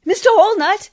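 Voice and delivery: high, loud cry across the water; calling out. Acted. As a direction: call him urgently from the river. Calling for help from the river.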